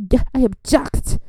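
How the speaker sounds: playful sassy objection with a lifted voice; light mock attorney voice; sassy lawyer. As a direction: strut it like a showy lawyer. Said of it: "I'd be like".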